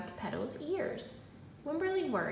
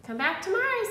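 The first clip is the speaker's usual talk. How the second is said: higher and louder goodbye call; 8 semitones higher, 7 dB louder than usual. Acted. Teacher calls to students at the door, loud and high. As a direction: call cheerily after the leaving kids.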